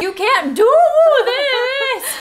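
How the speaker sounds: a squealing heroine; mock wail. Bella pleading to Edward, mock high wail. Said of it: "She howled at me".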